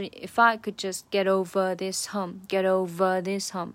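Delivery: over with ver punched; stress on over. Shown as a demonstration. Teacher showing the stressed ver in over. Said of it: "这个ver还是个重读的词".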